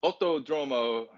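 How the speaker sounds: high, slow, hammed-up Spanish accent; botched Spanish accent. Host announcing the Mexican track's name, botched Spanish accent.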